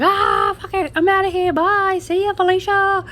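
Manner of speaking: high, loud mock-panicked getaway shout; panicked runner. Drug mule bolting from police, mock-panicked yell. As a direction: imitate a fleeing suspect yelling a quick goodbye. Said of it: "She's just like".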